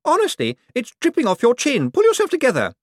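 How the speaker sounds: high, loud haughty woman; Poochie's voice. Acted. To Cosmo, a greedy high-pitched rich woman.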